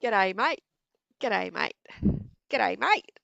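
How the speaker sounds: loud, clipped Aussie "G'day, mate"; Aussie accent. Shown as a demonstration. Asked to greet like an Aussie, loud Aussie accent. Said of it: "Now say".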